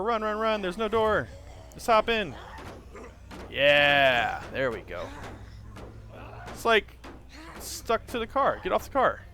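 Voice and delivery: high, urgent panicked calls; being chased. Real, not acted. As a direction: keep it breathless and urgent. Fleeing zombies in game.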